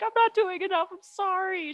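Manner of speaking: high-pitched mock tearful apology; retold teary, high-voiced confession; imitating tearful girl. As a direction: act out a girl about to cry. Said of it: "She starts crying".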